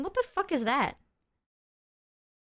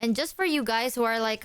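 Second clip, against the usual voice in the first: usual speaking voice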